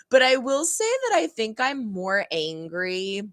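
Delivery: normal talking voice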